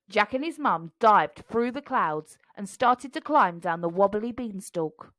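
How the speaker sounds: plain reading voice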